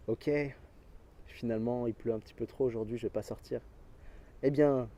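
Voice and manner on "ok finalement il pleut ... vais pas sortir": quiet excuse. Acted. Quoting the lazy inner excuse.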